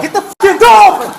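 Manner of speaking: high, forceful command; urgent angry order. Real, not acted. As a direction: demand it urgently, pitch way up. Officers struggling with detainee, urgent order.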